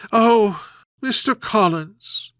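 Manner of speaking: a mild wail for sympathy; doleful voice. A narrator's reading. Mother opening her lament to Mister Collins, mildly doleful. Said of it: "In a doleful voice".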